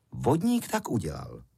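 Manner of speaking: plain reading voice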